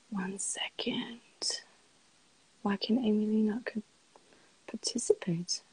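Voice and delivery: soft, near-whispered puzzling; quiet, half-whispered thinking aloud; muttering to self. Real, not acted. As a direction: murmur it to yourself as you troubleshoot. Troubleshooting the connection to herself.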